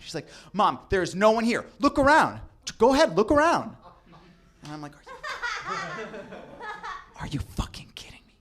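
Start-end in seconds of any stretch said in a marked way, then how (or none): 0.5-3.8 high, loud, defensive insistence; indignant protest. Retold or imitated. Daughter protesting to mom. 5.0-8.3 whispered laughing disbelief. Retold or imitated. Hiding in closet, disbelief.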